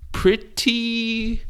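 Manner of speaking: PRETTY stretched long and high; drawn-out stress. Real, not acted. Hedging on a statistic, PRETTY held high. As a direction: hold "pretty" on a high note. Drawing out the word for effect.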